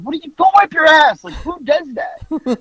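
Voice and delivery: high scolding, slowing on the last words; high, slowed exasperated scold; exasperated parent. Retold or imitated. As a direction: scold the kid, then drag out the disbelief. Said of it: "I was like".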